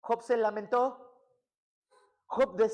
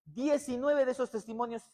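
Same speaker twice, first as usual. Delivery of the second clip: loud, high-pitched fervent preaching; preaching at full force; 5 semitones higher, 5 dB louder than usual. Acted. Preacher exhorting the congregation on family prayer, fervent loud preaching. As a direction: drive it home to the whole church.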